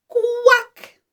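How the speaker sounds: high, bright-pitched quack; high demonstration quack; duck quack. Shown as a demonstration. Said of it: "Watch my mouth".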